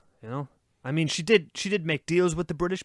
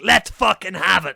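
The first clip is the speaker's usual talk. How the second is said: slowed, louder battle-ready growl of a pirate queen; rally her crew, slow and loud; tough pirate captain; 4 semitones higher, 8 dB louder, slower than usual. Retold or imitated. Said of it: "and is like".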